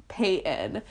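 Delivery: ordinary delivery, nothing marked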